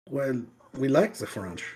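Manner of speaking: a light mock French accent; hint at French vowels, half-hearted. Natives voiced in a faint French accent. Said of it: "I'm suddenly doing French again".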